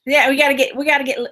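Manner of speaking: ordinary delivery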